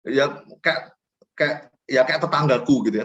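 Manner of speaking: ordinary delivery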